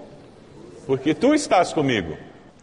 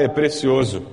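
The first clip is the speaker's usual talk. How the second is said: ordinary delivery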